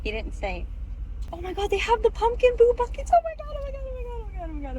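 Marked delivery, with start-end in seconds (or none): 1.3-4.8 high squealing oh my God; shrieking jackpot winner. Real, not acted. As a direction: squeal it like you just won. Sees pumpkin bucket in window.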